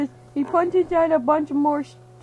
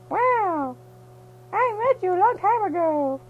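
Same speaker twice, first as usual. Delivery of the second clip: put-on high girlfriend voice; 7 semitones higher than usual. Mary Jane voiced in silly story.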